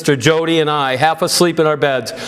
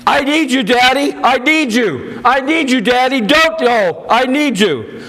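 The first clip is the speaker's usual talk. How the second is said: much higher, desperate pleading scream; 8 semitones higher than usual. A narrator's reading. Daughter begging her dying father to stay, screaming and much higher. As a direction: beg him with everything not to go. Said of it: "I screamed".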